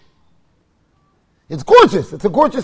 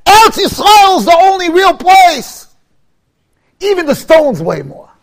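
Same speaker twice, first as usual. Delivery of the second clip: loud, high, slow declaring; rabbi's proclamation; 7 semitones higher, 7 dB louder than usual. Retold or imitated. Quoting Rav Gifter to the listeners, a loud slow proclamation. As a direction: proclaim it like a rabbi thundering from the pulpit. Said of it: "so he said".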